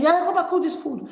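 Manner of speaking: high-pitched retold command; imitated bossy mother. Mother calling younger child to cook instead, imitated bossy order.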